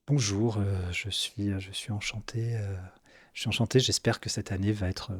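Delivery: soft, low velvet charmer; velvety voice. Acted. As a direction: purr it like a smooth seducer. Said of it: "une espèce de voix de velours comme ça".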